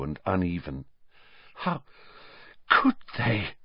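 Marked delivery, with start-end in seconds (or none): 1.5-3.5 Hermione choking back tears; catching in the throat, near crying; choked up. A narrator's reading. Hermione, horrified about Hagrid, choked and tearful. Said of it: "she choked".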